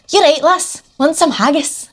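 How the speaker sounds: put-on cheery Scottish local accent. Arriving in Scotland, a light mock Scottish accent. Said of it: "Which was so Scottish".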